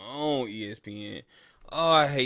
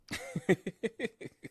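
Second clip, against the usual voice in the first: high giggle; 6 semitones higher, 8 dB quieter than usual. Real, not acted. Laughing after trash talk.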